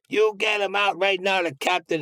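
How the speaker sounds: high-pitched mock yell at the captain; imitating Joe Colombo yelling; retold yelling. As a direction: bark the order up high, half-acted. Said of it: "And he's yelling".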